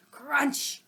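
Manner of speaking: ordinary delivery, nothing marked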